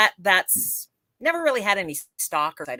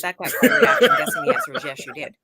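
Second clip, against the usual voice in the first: laughing; 6 dB louder than usual. Real, not acted. Caught off guard by question.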